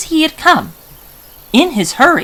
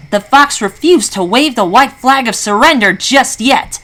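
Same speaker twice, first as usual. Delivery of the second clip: usual speaking voice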